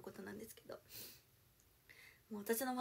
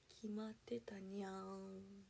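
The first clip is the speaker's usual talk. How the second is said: cutesy cat voice; 2 semitones lower, 7 dB quieter, much slower than usual. Pretend.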